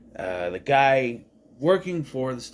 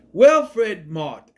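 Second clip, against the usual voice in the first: excited greeting; 5 semitones higher, 9 dB louder than usual. Retold or imitated. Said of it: "And he just".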